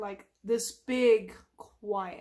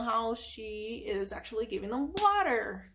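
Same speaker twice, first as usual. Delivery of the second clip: ordinary talk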